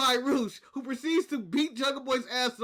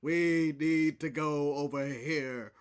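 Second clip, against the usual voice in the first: lower, slow, booming robot leader; slow Optimus Prime; 9 semitones lower, slower than usual. Retold or imitated. Host mocking Optimus Prime's slow promo delivery. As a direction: drag every word out like Optimus. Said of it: "how slow he talks".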